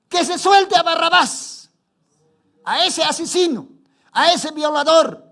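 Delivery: high, loud demand; the crowd yelling for Barabbas; crowd shouting. Acted. (la multitud gritando)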